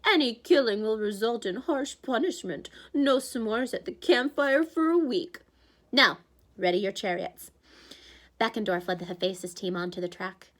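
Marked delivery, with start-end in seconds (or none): 0.1-7.5 a smug host lays down rules; Tantalus announcing. Acted. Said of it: "smiled at us like naughty children".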